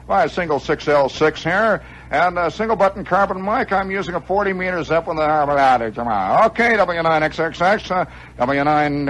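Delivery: high, excited on-air voice; eager young ham operator. Retold or imitated. Plays his young self on air.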